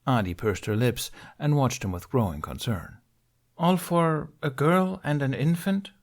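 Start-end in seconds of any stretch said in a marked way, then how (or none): 3.6-5.9 higher, slow baffled question; can't believe what she's hearing. A narrator's reading. Saisi Tin leans forward at Ben, baffled and slow. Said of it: "baffled by Ben's line of reasoning".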